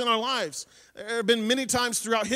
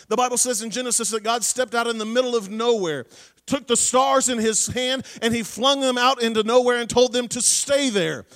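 louder, driving sermon voice; preaching at full force; 3 semitones higher, 6 dB louder than usual. Acted. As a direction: preach it out to the congregation. Preacher retells creation with fervour.

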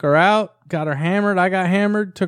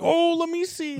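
eager coworkers; 14 semitones higher than usual. Retold or imitated. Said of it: "they're like".

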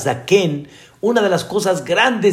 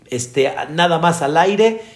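usual speaking voice

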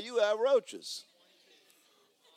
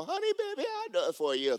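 doting parent; 10 semitones higher than usual. Retold or imitated. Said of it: "you go".

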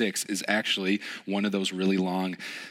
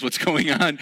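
louder, higher bewildered question; alarmed; 6 semitones higher, 7 dB louder, slower than usual. Retold or imitated. Camp leader baffled at the abandoned child, louder alarm. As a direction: sound truly thrown by it. Pastor retelling own alarm.

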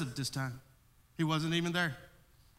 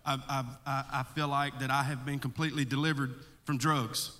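ordinary talk, nothing marked

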